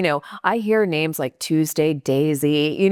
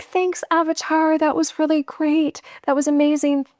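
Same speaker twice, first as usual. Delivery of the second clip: much higher, sing-song thanks; breezy, starry-eyed farewell; gushing goodbye; 10 semitones higher than usual. Retold or imitated. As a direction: gush over him like a fan.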